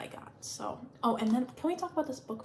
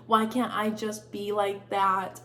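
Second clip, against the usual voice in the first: usual speaking voice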